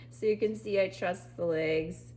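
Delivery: ordinary talk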